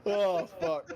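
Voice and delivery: high, loud laughing words; cracking up mid-sentence; laughing hard. Real, not acted. Cracking up at joke.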